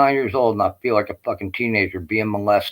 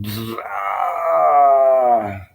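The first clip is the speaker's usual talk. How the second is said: ray gun zap and cry; 3 semitones higher, 3 dB louder than usual. Retold or imitated. Ray gun zaps him.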